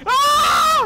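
very high, loud shriek; piercing cry of alarm; panicked scream. Real, not acted. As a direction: scream in sudden game terror. Screams at game danger.

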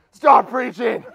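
mock demonic shout. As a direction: heckle loudly like a possessed man. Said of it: "someone stands up with a demon".

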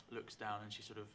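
ordinary talk, nothing marked

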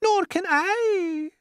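very high, long-drawn loud wail. A narrator's reading. Glowworm joins the ladybird's panic, wailing. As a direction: wail it out, stretching the words. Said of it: "wailed the glowworm".

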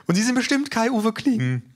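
a presenter sweeping in with a big, high voice; voluminous woman's voice. A narrator's reading. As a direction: boom out a bubbly, oversized greeting. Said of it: "eine voluminöse Frauenstimme".

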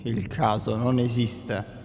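a light imitation of a sage, slowed right down; maestro Uguay. Quoting a wise kung fu master to viewers, lightly voiced and slow. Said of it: "Come diceva maestro Uguay".

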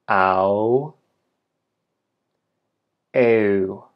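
each glide drawn out with a long gap after; "I, a, oi, ui, au, eu", held long for repeating; diphthong demo. Shown as a demonstration. Teacher giving learners sounds to repeat, slow diphthong demo. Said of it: "And one more time".

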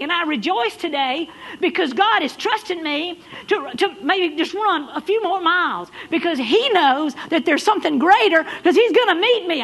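loud, high, driving preacher climb; preaching at full force. Acted. Pastor rallying the runners in the pews, loud and high. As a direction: lift the congregation with rising fervour.

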